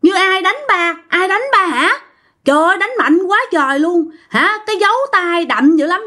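put-on high-pitched, startled child calling out to dad; acted alarm, pitched well up; alarmed cry. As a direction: blurt it out in shocked disbelief. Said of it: "bạn mới la lên".

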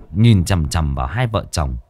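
usual speaking voice

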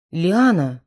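usual speaking voice

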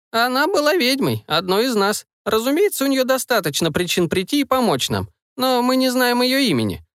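a hint of a wise old witch; old woman voice. Grandmother speaking.

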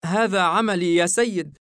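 embarrassed after the fall; shy. Acted. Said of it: "الفتاة بخجل". (الفتاة تجيب حاييم بخجل بصوت عالٍ)

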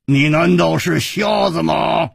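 loud, slow angry shout; 胡铁花瞪眼怒吼; glaring demand. A narrator's reading. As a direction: bark it at him, eyes wide. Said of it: "胡铁花瞪着眼睛说".

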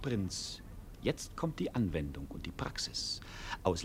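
low conspiratorial whisper. Acted. Servant whispering the plan to the prince. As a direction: lean in and whisper it.

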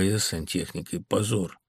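ordinary delivery

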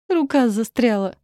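squeaky, pained cry; squeaking. A narrator's reading. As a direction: squeak it out in distress. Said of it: "пропищала я".

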